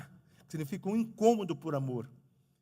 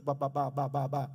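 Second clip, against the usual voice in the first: imitating a stutter; 4 semitones lower than usual.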